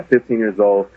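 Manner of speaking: ordinary delivery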